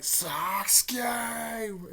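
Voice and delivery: high, breathy whispered name; whispered cry. Retold or imitated. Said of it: "And then he's like".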